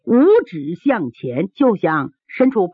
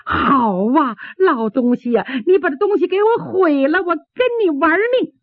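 high, rising angry threat; acted fury; 9 semitones higher than usual. Woman to old man who wrecked her carpet, acted fury. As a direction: build to an outraged high-pitched challenge.

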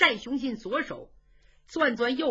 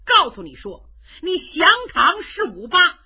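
angry; 4 semitones higher, 7 dB louder than usual. Acted. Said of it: "徐茂公可生气了".

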